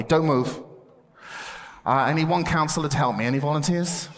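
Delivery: usual speaking voice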